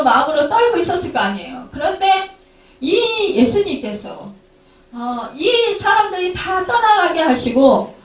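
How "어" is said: ordinary delivery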